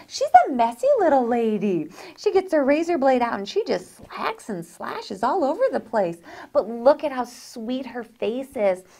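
normal talking voice

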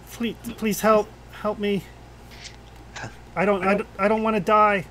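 high, stammered begging; frightened sobbing plea. Acted. Bound boy begging his rescuers for help, pleading through sobs. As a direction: act a terrified child tripping over the words. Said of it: "they're sobbing so hard they can't get actual words out".

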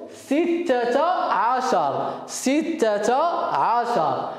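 each number drawn out slowly for the class; slow modelling. Shown as a demonstration. Teacher to young pupils, saying the number very slowly. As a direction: stretch every syllable so the children can copy. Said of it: "ونقول".

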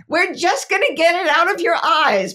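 put-on high, loud insistent little girl; toddler voice. Mom retells daughter holding her still, high bossy child voice. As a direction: boss mom around like a tiny stylist. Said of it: "And then she goes".

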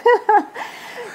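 high, giggling burst of laughter; short high-pitched laugh; real laugh. Real, not acted. Still laughing at the remark.

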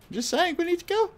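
high, put-on squeaky voice; squeaky nag. Real, not acted. Player mock-whining at teammates to move, high squeak. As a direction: pipe it up like a cartoon whine.

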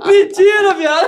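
high, laughing real disbelief; shocked disbelief. Real, not acted. Friend reacting to an embarrassing confession, high laughing disbelief.

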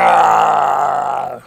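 long loud charging yell; battle yell. Real, not acted. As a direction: scream as you charge.

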